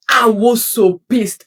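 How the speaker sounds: audibly annoyed, slow and loud; pissed off. Real, not acted. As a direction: let the irritation show in your voice. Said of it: "and you can hear it in my voice".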